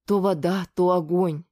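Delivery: ordinary delivery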